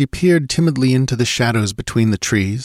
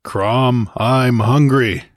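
louder, slow hungry grunt; 4 semitones higher, 4 dB louder, much slower than usual. A narrator's reading. Conan grunts to Olivia that he is hungry. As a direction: grunt it out like a starving barbarian. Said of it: "grunted the Cimmerian".

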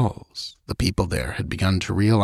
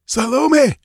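very high, desperate shriek; 4 dB louder than usual. A narrator's reading. Wounded priest shrieks to his queen through the crystal. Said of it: "shrieked the bloody head".

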